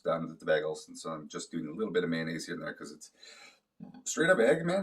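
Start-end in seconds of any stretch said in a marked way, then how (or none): 4.0-4.8 exasperated outburst. Real, not acted. Complaining eggs are bland.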